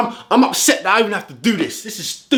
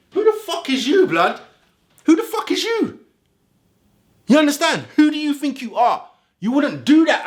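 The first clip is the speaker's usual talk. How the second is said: outraged; 7 semitones higher than usual. Real, not acted. Outraged rant at referees.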